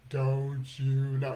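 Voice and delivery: low, stretched-out slow-motion voice. Shown as a demonstration. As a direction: drag every word like a slowed tape. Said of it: "And in slow motion".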